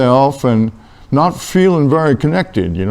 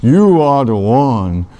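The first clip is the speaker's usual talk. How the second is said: slow, drawn-out divine reassurance; voice from the bush; 4 semitones lower, much slower than usual. Acted. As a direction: stretch each word out solemnly, like God calling. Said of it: "And the voice said".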